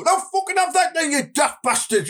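put-on high, yelled broad Borough accent; worst Borough accent. Telling a Geordie-caller off after batting them, worst Borough accent. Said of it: "put your worst borough accent on".

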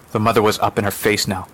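ordinary delivery

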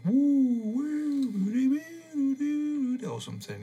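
high, wavering sung woo-doo noises; imitated background music. Shown as a demonstration. Podcaster imitates weird transient tape music, high airy crooning. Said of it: "transient background music going on".

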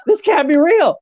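very high-pitched imitation of a panicking wife; acted frantic disbelief; wife freaking out. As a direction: squeal it in mock alarm, way up high. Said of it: "his wife's freaking out".

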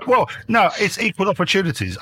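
high squeaky laughing protest. Real, not acted. Laughing defence after sexist joke.

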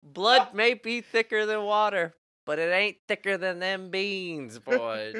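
high, loud comic proclamation; mock declaration. Comedian defending his aunt's beans to mom, mock-grave declaration. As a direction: declare it like a hammed-up family ultimatum.

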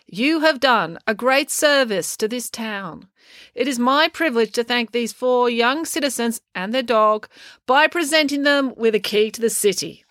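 lightly voiced mayor wrapping up a speech; speechifying mayor. A narrator's reading. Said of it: "Mayor Albright concluded".

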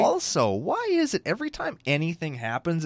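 ordinary talk

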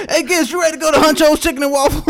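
mock ad pitch. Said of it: "And that's a commercial".

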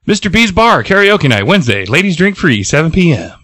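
high, sing-song promo read; announcer. Acted. Reading karaoke night page for listeners, announcer-like. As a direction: sell it like a bar advert.

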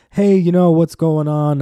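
a parody of a stand-up's cheery hello; mock stand-up opener. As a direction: play a cheesy club comic working the room. Mock stand-up comic greeting the crowd.

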